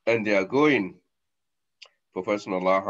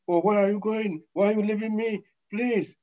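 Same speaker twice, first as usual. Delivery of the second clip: high, begging wail; high pleading cry; 11 semitones higher than usual. Acted. Dead person begging mourners to stay, high crying plea. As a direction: beg them not to leave, almost crying.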